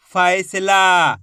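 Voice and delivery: फैसला said as one joined word, still slow; joined slowly. Shown as a demonstration. Teacher joining the syllables into one word, slowly. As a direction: blend फैसला together at a careful pace. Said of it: "तो मिलके बन जाएगा".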